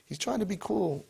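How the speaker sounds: imitating the teens. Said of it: "they were telling me".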